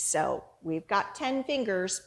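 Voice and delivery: plain reading voice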